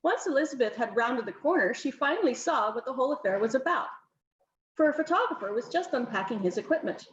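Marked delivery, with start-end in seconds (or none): none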